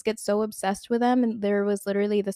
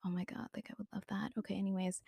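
whisper; 14 dB quieter, much faster than usual. Real, not acted. Hushed aside after confession.

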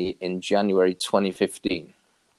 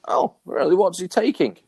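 higher-pitched innocent question; retold puzzlement; 9 semitones higher than usual. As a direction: act out missing the point. Misunderstands news that friend passed.

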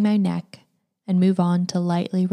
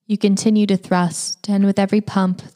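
usual speaking voice

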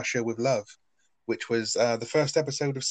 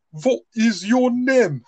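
put-on slow, much higher old man's voice; imitating Albert; 13 semitones higher, 7 dB louder, much slower than usual. Imitating Albert asking the German girl her name, slow and high. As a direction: drag out each word like a doddering old sailor. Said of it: "Albert just walks up and he's like".

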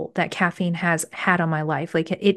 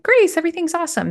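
carefree mock quote; 9 semitones higher, faster than usual. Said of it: "they were just like".

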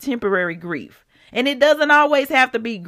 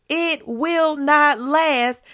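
slow emphatic; 6 semitones higher, much slower than usual. Real, not acted. Preacher stressing every word.

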